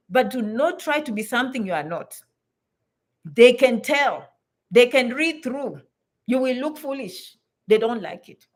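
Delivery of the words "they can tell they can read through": very slow, firm, each word weighed; slow blunt warning; slow emphatic warning. Real, not acted. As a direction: stress THEY and let it land.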